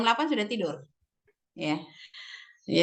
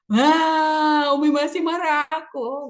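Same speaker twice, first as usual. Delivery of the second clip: put-on high, loud little kid's complaint; small child sulking at mum; child's voice; 5 semitones higher, 5 dB louder, much slower than usual. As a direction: voice the hurt child, pitched up. Mother voicing her young child.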